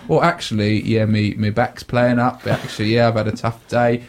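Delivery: low, quiet, downbeat grumble; mock moaning. Host mimics an over-honest answer, low and moaning. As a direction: put on a dreary tale of woe. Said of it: "They're not interested in that".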